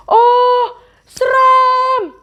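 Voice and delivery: high loud fake fright; hammed-up scared shout; mock scared cry. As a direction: shriek it like a scared kid. Scared reaction to scary faces.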